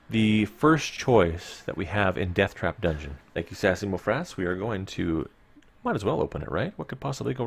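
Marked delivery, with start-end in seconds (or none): none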